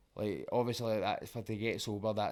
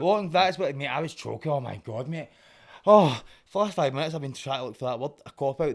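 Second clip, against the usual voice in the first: relieved burst, suddenly loud; excited; 3 semitones higher, 10 dB louder than usual. Real, not acted. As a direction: blurt it out with relief. Finally finds the lost word.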